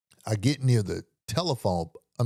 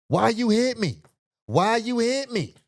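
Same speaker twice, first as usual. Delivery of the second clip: high, mock-deranged whine, far higher than his own voice; high crazy stranger; 12 semitones higher than usual. Stranger confronting him on the sidewalk, high mock-crazy whine. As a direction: play the unhinged stranger, pitch way up. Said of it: "crazy person".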